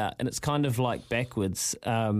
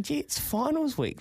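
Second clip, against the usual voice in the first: excited thought; 10 semitones higher than usual. Retold or imitated. Retelling his thoughts before final.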